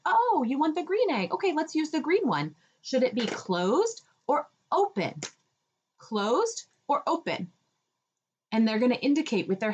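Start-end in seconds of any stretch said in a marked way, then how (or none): 0.0-7.5 put-on toddler-talk lilt. Shown as a demonstration. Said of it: "input that word".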